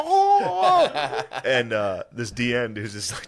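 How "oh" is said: very high, loud mock whoop; imitated celebratory "oh" scream; Philip Rivers excited whoop. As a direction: squeal like an excited little kid. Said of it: "he's excited".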